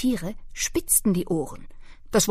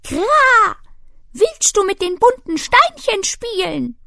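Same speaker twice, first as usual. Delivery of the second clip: hohe, laute Rabenstimme mit Krächzer; 13 semitones higher, 8 dB louder than usual. Acted. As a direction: spiel den Raben, hoch und laut. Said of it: "ein Rabe ... fragte". (Rabe fragt die kleine Eule, hoch und laut)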